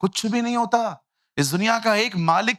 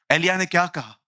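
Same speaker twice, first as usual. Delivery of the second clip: ordinary talk, nothing marked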